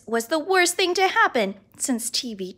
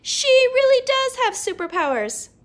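put-on excited kid; 11 semitones higher, 4 dB louder than usual. Ryan excited in story.

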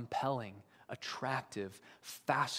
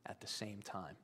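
a whispered aside to the congregation; hushed; 5 semitones lower, 14 dB quieter than usual. Real, not acted. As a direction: drop to a whisper. Awe at the gap before God.

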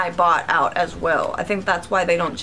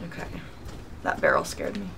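whispered; 10 dB quieter than usual. Real, not acted. Startled by a barrel in-game.

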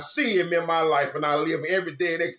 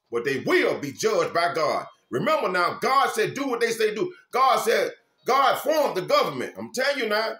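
raised, driving preacher's voice; preaching at full force; 5 semitones higher, 4 dB louder than usual. Acted. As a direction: preach it out to the flock. Preaching to viewers.

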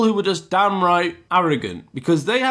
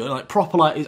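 ordinary delivery, nothing marked

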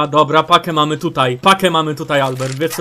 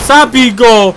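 urgent shout; 3 semitones higher, 7 dB louder, slower than usual. Real, not acted. Ordering a kill.

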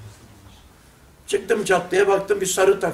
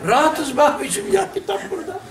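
laughing brush-off; 8 semitones higher than usual. Retold or imitated. Youth laughing, brushing him off.

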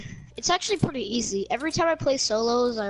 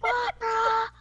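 retold yell; 11 semitones higher, much slower than usual. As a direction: bark it back at him. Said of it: "and I was like".

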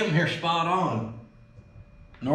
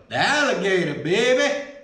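attempted Cajun accent. Trying the alligator jerky on camera, attempted Cajun accent. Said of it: "that's my Cajun voice, or attempt".

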